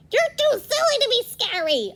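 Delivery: high, loud mocking crow voice; taunting crow. Crow taunting scarecrow, high and mocking. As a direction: sneer it like a cheeky bird.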